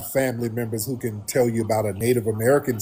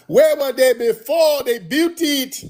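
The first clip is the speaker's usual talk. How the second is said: much higher, slow probing question; mock skeptic; 11 semitones higher, slower than usual. Host voicing a skeptic grilling him, high and slow. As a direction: drag out the gotcha question.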